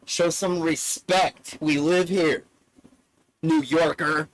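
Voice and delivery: loud mock telling-off; acted loud rebuke; imitated angry father. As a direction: play a father scolding out-of-towners, loudly. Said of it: "And then my father says".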